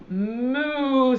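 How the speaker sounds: long mooing cow sound; cow's moo. Acted. Teacher voicing the cow in a farm poem, mooing. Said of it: "said the cow".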